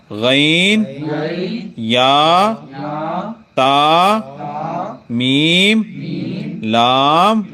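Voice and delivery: slow, drawn-out letter sounds, each held apart; letter recitation. Shown as a demonstration. Teacher reciting the alphabet to pupils, slow and drawn out.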